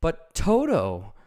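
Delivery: Dorothy worried for Toto; anxious. A narrator's reading. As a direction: fret over the little dog. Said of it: "said the girl anxiously".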